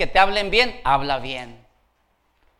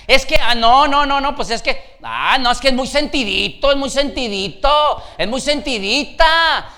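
higher, protesting mock excuses; mock excuse-making; 9 semitones higher, 8 dB louder than usual. Preacher mimicking excuses about a touchy person, mock protest. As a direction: imitate someone insisting, pitch lifted.

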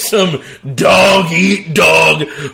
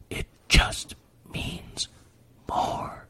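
breathy whisper, much softer; whispered slogan; 16 dB quieter, much slower than usual. Acted. Host mocking the conference slogan, whispered.

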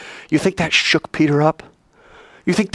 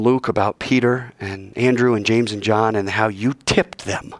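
low, measured, heartfelt praying; prayer; 4 semitones lower than usual. Real, not acted. Preacher closing the sermon to God, earnest low prayer. Let's pray.

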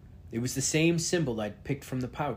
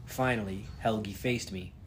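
usual speaking voice

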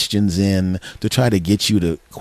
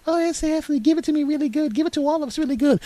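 very high falsetto women; girlfriends in ecstasy; 4 dB quieter, faster than usual.

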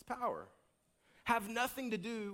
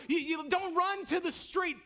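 loud, high impassioned preaching; fiery sermon climax; full-force preaching; 8 semitones higher, 4 dB louder than usual. Acted. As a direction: preach it hard, pleading with them.

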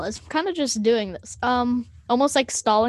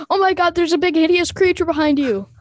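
higher, louder mock alarm; hammed-up warning meant to trick the listener; fake panic; 5 semitones higher, 5 dB louder than usual. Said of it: "point to him and be like".

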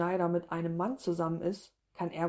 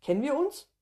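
higher, puzzled, rising at the end; puzzled question; 8 semitones higher, 3 dB louder than usual. A narrator's reading. Woman addressed by name by a stranger, puzzled. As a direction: ask a stranger, pitch lifted in confusion.

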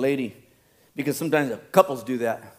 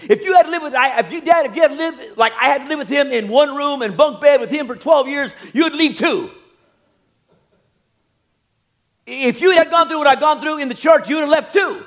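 high, loud aggrieved protest; indignant excuse-making; 10 semitones higher, 5 dB louder than usual. Acted. Son blaming his brother to Dad, indignant protest. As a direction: plead your case, worked up and raised.

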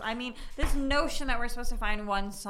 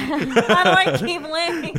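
laughing despair; 6 semitones higher, 10 dB louder, slower than usual. Acted. Joking despair over accent date.

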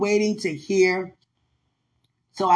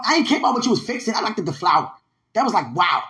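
ordinary talk

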